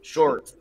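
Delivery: "Short" with a strong sounded R; American R. Shown as a demonstration. Teacher showing the R to pronounce in "Short". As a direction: say "Short" American-style, R before the T. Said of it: "le R qu'on va prononcer".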